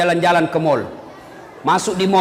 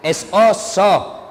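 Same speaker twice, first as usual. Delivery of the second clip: slow spelling; much slower than usual. Retold or imitated. Father sounding out letters.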